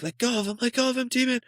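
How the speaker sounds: imitating a panicked guard's high yelp; retold scream. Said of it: "he's screaming".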